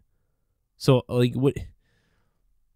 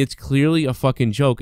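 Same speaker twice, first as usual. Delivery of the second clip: ordinary talk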